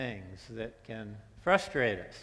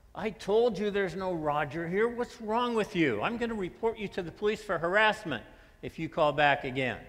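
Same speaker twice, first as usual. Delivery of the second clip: higher, louder exasperated scolding; angry stranger; 7 semitones higher, 4 dB louder than usual. Acted. Stranger threatening the caller with police, higher and angry. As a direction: threaten him like you've had enough.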